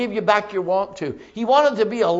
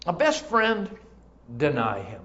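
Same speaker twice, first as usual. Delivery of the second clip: usual speaking voice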